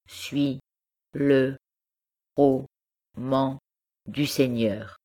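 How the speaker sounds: slow, halting, each syllable set apart by a pause; lightly voiced child spelling out the words; schoolchild reading syllable by syllable. A narrator's reading. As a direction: sound it out like a little pupil at school. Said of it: "qui lisait en s'arrêtant à chaque syllabe".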